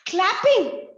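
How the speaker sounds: ordinary delivery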